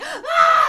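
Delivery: loud, very high shriek; acted-out shriek of panic; mock terrified scream. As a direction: scream as you run away. She screams while fleeing.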